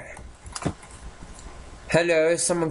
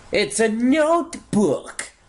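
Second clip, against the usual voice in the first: excited; 9 semitones higher than usual. Real, not acted. Finding a notebook.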